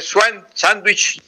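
"sándwich" said the English way as "swan-sandwich", slowly; English pronunciation. Shown as a demonstration. Professor contrasting with the English word, slow English "sándwich". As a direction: give the English original "sándwich", drawn out. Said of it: "esa es la palabra del inglés".

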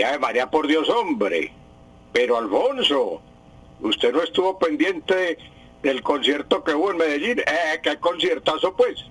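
cantadito paisa de Medellín, exclamativo; acento paisa. Shown as a demonstration. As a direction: imita el habla antioqueña con entonación animada. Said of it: "el acento es el que tienen los antioqueños". (acento paisa imitado, con exclamaciones alargadas)

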